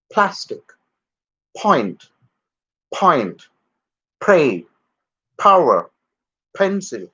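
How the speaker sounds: "plastic" "point" "project" with a strong puff of air on p; aspirated English p. Shown as a demonstration. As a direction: breathe out hard on every p. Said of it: "in the actual English pronunciation when the word is pa".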